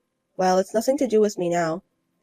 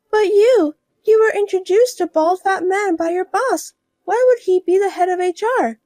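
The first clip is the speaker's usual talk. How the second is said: higher, pushy woman's voice; higher voiced woman arguing back; protesting rival wife; 10 semitones higher, 5 dB louder than usual. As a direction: press her doubt in a higher voice.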